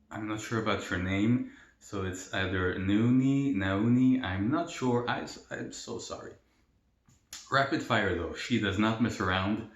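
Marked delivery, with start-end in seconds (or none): none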